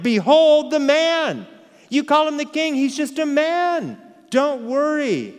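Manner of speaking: high, loud triumphant proclamation. Retold or imitated. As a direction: cry it out like a victor showing off a prize. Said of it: "Pilate cries out in a voice of triumph".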